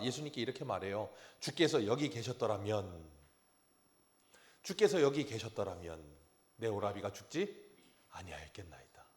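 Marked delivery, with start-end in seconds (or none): 1.4-9.0 solemn scripture reading. Acted. Reading Martha's words solemnly.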